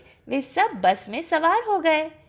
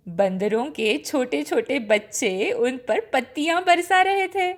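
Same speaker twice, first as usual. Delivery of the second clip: ordinary delivery, nothing marked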